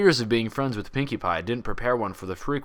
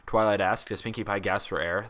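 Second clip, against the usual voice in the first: ordinary delivery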